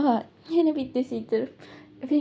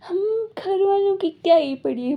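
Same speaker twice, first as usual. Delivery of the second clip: higher, louder bitter complaint; bitter outburst; 7 semitones higher, 6 dB louder than usual. Retold or imitated. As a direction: throw it at him, hurt. Wife's bitter outburst at husband. (पति पर ताना मारते हुए ऊँची आवाज़ में)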